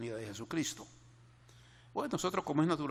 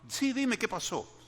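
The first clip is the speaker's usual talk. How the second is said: pleading mother; 11 semitones higher, 5 dB louder than usual. Retold or imitated. Mother insists, voiced high.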